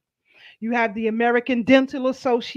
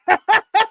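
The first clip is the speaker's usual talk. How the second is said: sudden high burst of laughter; short high laugh; 9 semitones higher, 8 dB louder than usual. Real, not acted. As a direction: laugh out loud. Co-host laughing at quote.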